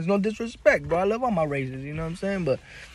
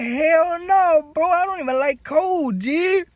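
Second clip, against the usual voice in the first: much higher, louder protest; loud denial; 5 dB louder, slower than usual. Retold or imitated. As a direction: swear you weren't escaping. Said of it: "I said".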